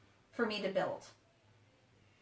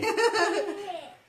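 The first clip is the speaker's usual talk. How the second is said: tickled laugh; 7 semitones higher, 3 dB louder than usual. Real, not acted. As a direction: burst of high laughter. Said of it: "Are you tickling Daddy's feet?".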